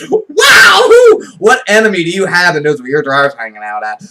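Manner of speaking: high, loud, laughing "Wow"; cracking up while asking; mock outrage through laughter; laughing disbelief. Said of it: "laughs while quoting".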